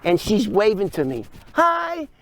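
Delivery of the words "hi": much higher-pitched mock hello; acted friendly greeting. Old woman waving at the car thief, high acted greeting.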